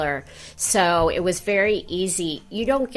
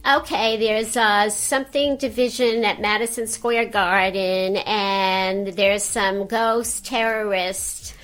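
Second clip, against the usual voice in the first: receptionist's New York accent, pitched up at first; Janine; 4 semitones higher than usual. Shown as a demonstration. Secretary reports ghost calls to Ghostbusters, in character. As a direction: answer the phone as a brisk New York secretary. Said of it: "I certainly can do Janine".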